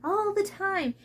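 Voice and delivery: high ranting cartoon squirrel; Foamy the Squirrel yelling. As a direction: rant it like an angry squirrel. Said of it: "who yells at you about everything".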